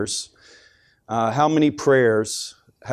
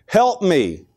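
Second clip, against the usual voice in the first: acted plea, pitched up; crying out to God; 4 semitones higher than usual. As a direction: beg for help in a higher voice. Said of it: "a mother cried out to the Lord".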